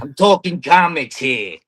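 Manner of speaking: loud, slow Brooklyn wiseguy; Brooklyn accent. Pretend. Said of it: "rate your Brooklyn accent".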